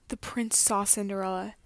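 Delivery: usual speaking voice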